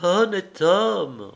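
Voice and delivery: put-on drunk with enthusiasm. Liuba repeating his words, ecstatic and slow. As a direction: savour "Honnête homme" with wild delight. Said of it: "ivre d'enthousiasme".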